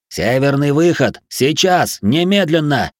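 emphatic high-pitched commands; urgent order. A narrator's reading. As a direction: demand immediate action, word by word. Ordering after losing patience.

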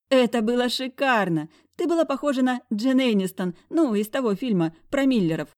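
high, loud gushing friend; giggling friend. Acted. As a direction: gush with a giggle. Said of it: "хихикнула подруга".

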